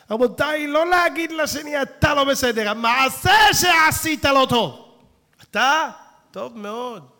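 loud, high preaching shout. Real, not acted. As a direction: thunder it at the congregation. Preacher thunders a warning. (קריאה רמה של מטיף)

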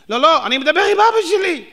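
indignant mourner. Retold or imitated. Mourner answering back.

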